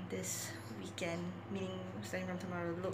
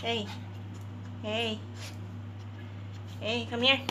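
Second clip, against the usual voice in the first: calling dog; 3 semitones higher than usual. Real, not acted. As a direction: call the dog over.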